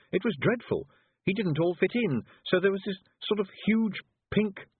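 high-pitched, mildly horrified; distressed recollection. A narrator's reading. Tiffany recalling the frog spell gone wrong, mildly distressed. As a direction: relive it with rising dismay until cut off. Tiffany recounts a horror, upset.

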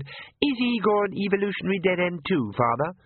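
put-on high, sneering girl; vexed daughter. A narrator's reading. Daughter mocks Igor to her father, high. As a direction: sneer like a spoilt teen. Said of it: "the vexed one said".

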